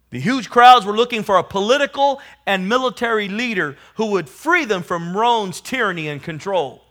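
loud, high preaching swell; full-force preaching. Acted. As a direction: lift it up over the crowd. Preacher swells on crowds' hopes.

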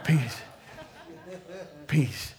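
hint of a mellow stoner peace sign. Shown as a demonstration. Pastor mocking the wrong kind of peace, a hint of mellow stoner. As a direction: drawl out a mellow laid-back peace. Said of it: "I'm not talking about this kind of peace".

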